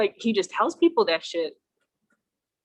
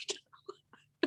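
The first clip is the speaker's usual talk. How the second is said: whisper; 13 dB quieter, slower than usual. Real, not acted. Revealing punchline.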